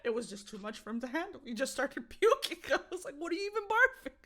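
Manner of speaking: loud, high-pitched talk through laughter; laughing hard. Real, not acted. Owner tells of dog puking from stress, laughing loudly. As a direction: struggle through the story, cracking up.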